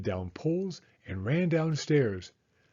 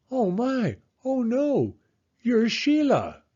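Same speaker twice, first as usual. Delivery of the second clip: high scolding that climbs even higher, slowing at the realisation; scolding turning to dismay; 7 semitones higher than usual. Acted. Father at the door scolds a filthy girl, then recognises Sheila, high and dismayed. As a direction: tell off the racket-maker, then gasp it's your daughter.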